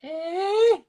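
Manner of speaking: put-on very high, far-off old lady squawk; hammed-up old woman voice; mock deaf old lady. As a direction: croak like a hard-of-hearing granny. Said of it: "he's like".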